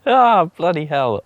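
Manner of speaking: loud, slow groan of dismay; drawn-out loud "Ah, bloody hell"; exasperated exclamation. Real, not acted. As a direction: let it out at the ruined bed. Exasperated at garden damage.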